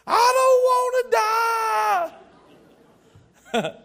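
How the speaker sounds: put-on high, loud terrified child's scream; little girl screaming. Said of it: "She cried out at the top of her lungs".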